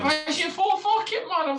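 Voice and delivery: loud, high outburst; exasperated outburst. Real, not acted. Fed up with Division Four, an exasperated loud outburst. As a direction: throw up your hands in exasperation.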